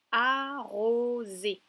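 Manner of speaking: "Arroser" very slow, each vowel stretched; syllable by syllable. Shown as a demonstration. Teacher repeats a word to locate the O, syllable by syllable. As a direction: sound out "Arroser" in three long beats. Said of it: "J'aimerais que tu retrouves la syllabe".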